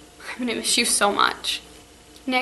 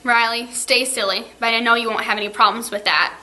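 ordinary talk

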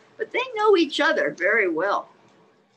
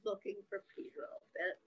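ordinary delivery

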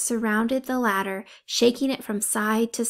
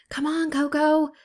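higher, urgent call upward; urging shout; 6 semitones higher than usual. A narrator's reading. Ollie shouts up to Coco climbing the post. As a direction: cheer her on from below. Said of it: "she shouted".

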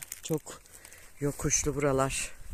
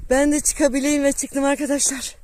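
excited; 7 semitones higher, 4 dB louder than usual. Real, not acted. Reaching summit, excited calling.

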